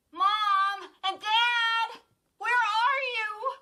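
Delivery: high, loud, very slow cry for parents; cry aloud. A narrator's reading. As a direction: call out through the house. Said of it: "she cried aloud".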